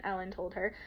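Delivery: usual speaking voice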